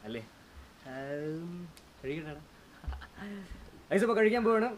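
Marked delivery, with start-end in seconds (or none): 3.9-4.7 excited. Real, not acted. About to eat spicy food.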